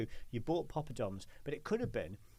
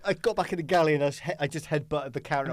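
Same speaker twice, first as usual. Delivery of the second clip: fast and louder, higher and breathless; frantic retelling; 4 semitones higher, 7 dB louder than usual. Retold or imitated. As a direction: rush through it in panic. Chef retelling his meltdown.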